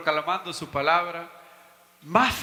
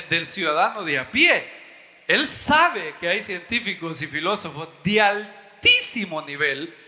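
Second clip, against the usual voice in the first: normal talking voice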